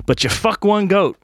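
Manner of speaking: put-on slow, high Irish-accented punchline with a laugh breaking in; slowed Irish accent punchline, cracking up. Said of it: "try my best at an Irish accent".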